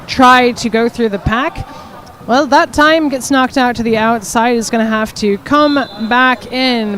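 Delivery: loud, projected sports announcing; strong, steady roller derby commentary; play-by-play commentary. Real, not acted. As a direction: call the action live to the crowd.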